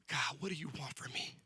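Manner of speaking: hushed plea to God; soft whispered prayer. Acted. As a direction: whisper the question upward.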